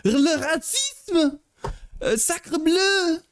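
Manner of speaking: very high, slow mock-French outcry; silly Frenchman. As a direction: draw it out like a scandalized cartoon Frenchman. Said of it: "French people sound so silly".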